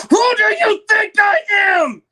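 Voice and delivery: high, loud screaming fight; furious shout. Acted. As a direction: scream it at your husband.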